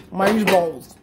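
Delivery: loud gush. Real, not acted. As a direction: shout the word with delight. Repeats word louder.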